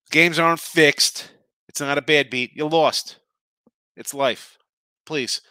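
loud, slow and emphatic scolding; fed-up loud scolding; angry rant. Real, not acted. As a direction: lay down the law, each word hammered. Ranting at whiny bettors.

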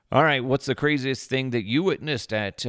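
ordinary talk

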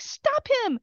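very high-pitched urgent cry; shrieky Wicked Witch-like plea; retold witchy shriek. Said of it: "I sound like the Witched Witch of the West".

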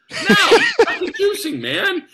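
high, loud outburst on a retold protest; pissed off. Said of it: "getting more and more pissed off".

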